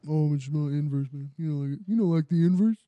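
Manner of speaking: a hint of laid-back friend; mock impression of friend. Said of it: "And you're just like".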